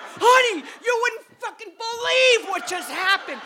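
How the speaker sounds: high, loud, bursting shout; excited shout. Retold or imitated. To Deirdre arriving home, high excited shout. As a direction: blurt it out at her, hyped.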